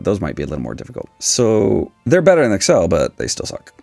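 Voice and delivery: ordinary talk, nothing marked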